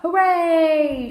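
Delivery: high, loud, drawn-out cheer; shouted cheer. A narrator's reading. Children cheering the news of arrival, shouted. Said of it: "shouted all the children at once".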